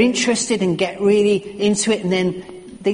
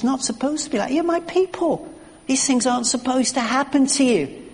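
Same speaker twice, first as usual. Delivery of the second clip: higher, lightly pleading voice of God; voicing God; 7 semitones higher than usual. God speaking to his wayward people, lightly voiced. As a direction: plead a little, pitched up. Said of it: "God says".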